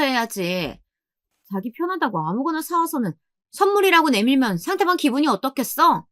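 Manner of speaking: high-pitched lecturing refusal; dismissive sister-in-law. A narrator's reading. Sister-in-law rejects the bride's souvenir, dismissive. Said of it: "형님은 요지부동이셨습니다".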